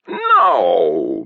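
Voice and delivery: long drawn-out roar of no; bellowing. A narrator's reading. Satirist interrupting the rehearsal, exasperated bellow. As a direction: cut them off with an exasperated bellow. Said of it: "bellowed the satirist".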